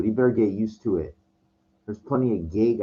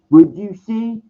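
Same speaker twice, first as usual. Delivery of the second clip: much higher and louder, imitating a tough guy's demand; mock angry challenge; 12 semitones higher, 10 dB louder, slower than usual. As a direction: bark it like a guy squaring up. Retold street confrontation, dude challenges.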